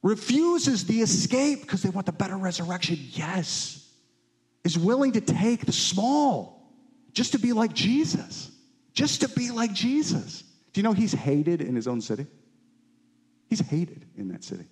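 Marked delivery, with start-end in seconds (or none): 0.0-10.4 voice of the witnesses. Retold or imitated. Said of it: "cloud of witnesses is like".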